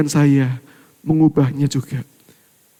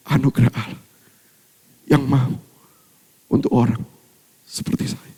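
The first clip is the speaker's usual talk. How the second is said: lower, fading into a choked whisper; voice breaking; 5 semitones lower than usual. Real, not acted. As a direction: let the voice crack and fade. Voice breaking on grace. (suara tercekat hampir berbisik)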